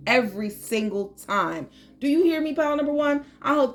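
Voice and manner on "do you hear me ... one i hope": calling out. Real, not acted. Urging the viewers.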